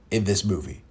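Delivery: usual speaking voice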